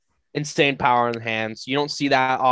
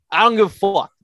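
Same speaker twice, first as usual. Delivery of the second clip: higher and louder, hitting "dont" hard; emphatic repeat; 6 semitones higher, 5 dB louder than usual. Real, not acted. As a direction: punch it out the second time. Repeats line, second more forceful.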